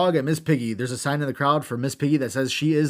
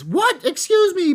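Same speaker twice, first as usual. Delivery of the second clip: high, louder indignant EXCUSE ME; Vicky's excuse me; 6 dB louder, slower than usual. Retold or imitated. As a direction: shriek it like Vicky Guerrero. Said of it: "Vicky says".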